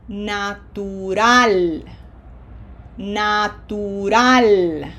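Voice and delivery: "Natural" slowly as na-tu-RAL, pure Spanish vowels; Spanish, not English. Shown as a demonstration. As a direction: stretch "Natural" by syllables, stressing the final "-ral". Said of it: "pronounce this word using the rules that we have learned for Spanish".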